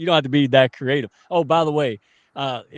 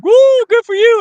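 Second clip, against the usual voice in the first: high, loud imitation of a cheer; mock excited cheer; 13 dB louder than usual. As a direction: mimic her cheering at a yawn. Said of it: "What the hell?".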